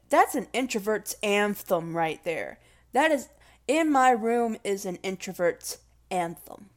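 usual speaking voice

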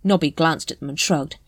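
ordinary delivery

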